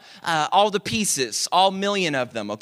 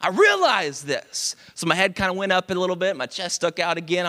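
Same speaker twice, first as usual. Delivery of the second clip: normal talking voice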